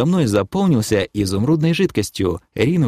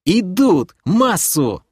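high-pitched alarmed call; urgent warning; 9 semitones higher, much slower than usual. Acted. Kira spots monsters coming, urgent cry. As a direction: call out the incoming attack.

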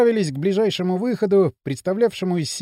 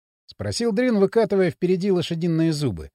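usual speaking voice

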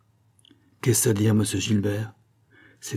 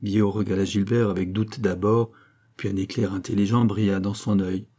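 ordinary delivery

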